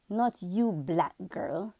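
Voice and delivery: lightly voiced snide little girl; mean little girl. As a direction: toss it off like a dismissive child. Schoolgirl's mean words retold.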